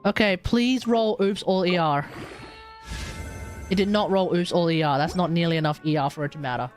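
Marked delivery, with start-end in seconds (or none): none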